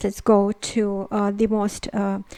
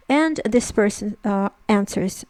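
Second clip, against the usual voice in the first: ordinary talk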